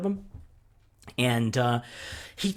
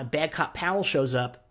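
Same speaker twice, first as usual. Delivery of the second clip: ordinary talk, nothing marked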